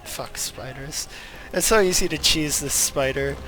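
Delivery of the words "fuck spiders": ordinary delivery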